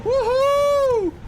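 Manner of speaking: loud, very high cheer; elated ride whoop; joyful whoop. Real, not acted. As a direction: let out a soaring woohoo. Happy on the monorail ride.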